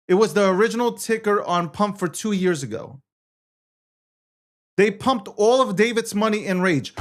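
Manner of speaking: usual speaking voice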